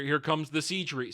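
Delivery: usual speaking voice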